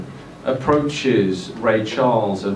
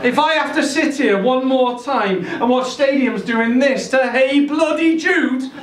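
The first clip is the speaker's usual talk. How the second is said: Scouse rant; 9 semitones higher than usual. Acted. Lennon ranting in Scouse voice.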